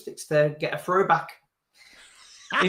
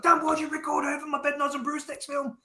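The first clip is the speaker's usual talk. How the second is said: mock accusation; 9 semitones higher, 4 dB quieter than usual. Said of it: "I was like".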